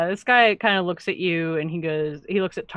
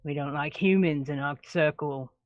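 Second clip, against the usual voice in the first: softer, lower half-orc voice; half-orc dismissal; 3 semitones lower, 6 dB quieter than usual. Acted. Half-orc rejecting the human, low and cold. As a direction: coldly turn him away.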